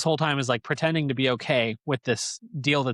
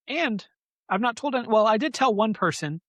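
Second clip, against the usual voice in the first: high little fish voice; 10 semitones higher than usual. Retold or imitated.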